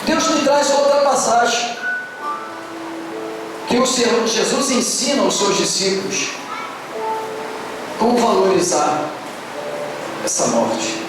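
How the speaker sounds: ordinary talk, nothing marked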